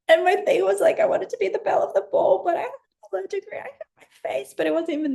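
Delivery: bubbly high retelling; excited, high. Real, not acted. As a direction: gush it, half laughing. Laughing anecdote, pitched up.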